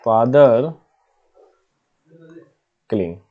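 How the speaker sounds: Father and killing said slowly as separate words with a long pause between; slow dictation. Shown as a demonstration. Teacher dictating to students while writing, slow and spaced. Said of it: "ऐसे बोल सकते हो".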